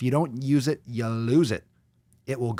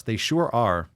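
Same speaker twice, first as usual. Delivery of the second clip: normal talking voice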